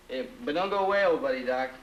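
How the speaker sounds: usual speaking voice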